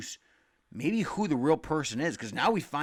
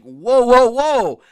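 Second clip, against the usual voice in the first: much louder, higher alarmed whoa; startled whoa; 10 semitones higher, 17 dB louder, much slower than usual. Retold or imitated. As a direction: back off a knife in mock alarm. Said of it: "And I'm like".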